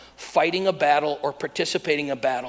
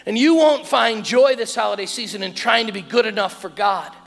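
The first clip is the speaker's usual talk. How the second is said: raised, ringing repeated refrain; emphatic preaching; 7 semitones higher, 5 dB louder than usual. Real, not acted. Preacher warning congregation against striving for joy, emphatic proclamation.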